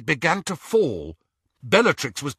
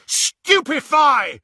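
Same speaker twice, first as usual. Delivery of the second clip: high, loud, drawn-out spell cast; yelled spell; 11 semitones higher, 6 dB louder, slower than usual. A narrator's reading. Harry casting at Greyback, loud yell. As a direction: hurl the spell with everything. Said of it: "and yelled".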